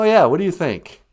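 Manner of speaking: ordinary talk, nothing marked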